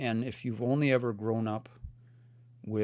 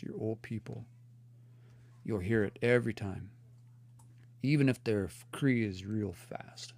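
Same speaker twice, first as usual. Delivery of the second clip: ordinary delivery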